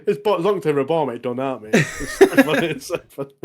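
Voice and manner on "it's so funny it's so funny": laughing. Real, not acted. Laughing at the scene.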